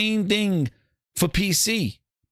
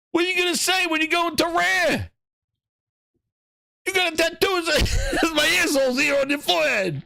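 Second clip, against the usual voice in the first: excited teasing shouts; 5 semitones higher than usual. Acted. Teasing shouts about tattoo.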